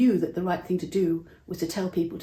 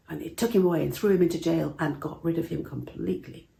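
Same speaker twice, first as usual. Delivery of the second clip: plain reading voice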